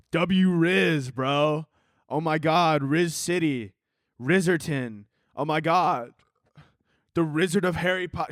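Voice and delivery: mock hype bros. Bros fawning over someone's Riz, mock-amazed. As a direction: gush like an over-impressed bro.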